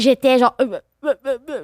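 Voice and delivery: mock stutter, stuck on repeated short syllables; imitated childhood stutter. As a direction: act out getting stuck before the word comes. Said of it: "je bégayais beaucoup quand j'étais jeune".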